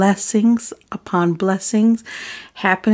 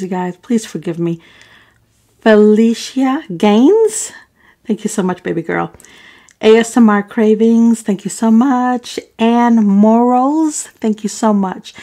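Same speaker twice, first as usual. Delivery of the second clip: usual speaking voice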